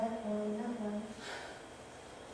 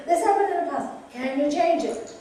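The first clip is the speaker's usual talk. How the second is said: mock complaint; 4 semitones higher, 8 dB louder than usual. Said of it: "Again, the same thing".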